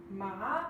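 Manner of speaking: ma rising upward; second tone ma. Shown as a demonstration. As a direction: slide ma up like a question. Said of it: "which is an upward slant".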